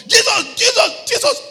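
very high, very loud repeated cries; frantic shouting. Retold or imitated. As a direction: scream the name in panic. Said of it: "he said".

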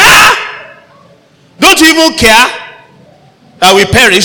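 high, loud frightened cry; terrified disciples shouting over the storm; panicked shout. Shown as a demonstration. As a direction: yell it like men sure they will drown. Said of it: "but that's not how they sounded".

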